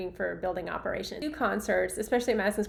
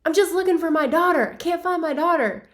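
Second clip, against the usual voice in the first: higher, louder mock-frantic dad; imitating Chevy Chase; 8 semitones higher, 8 dB louder than usual. Chevy Chase searching the aisles for his daughter, loud mock worry. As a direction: play the searching father, a bit hammed-up. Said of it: "And he's like".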